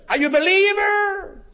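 더 높이 끌어올려 몰아붙이는 질문. Retold or imitated. Pastor presses third time.

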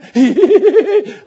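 high, loud burst of laughter. Real, not acted. Preacher laughing at the brother's fate, loud and high. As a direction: laugh out at the irony.

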